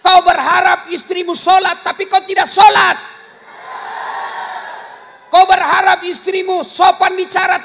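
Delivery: angry preaching shout. Acted. Preacher scolding husbands who curse their wives, loud and angry. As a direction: hammer the hypocrisy home.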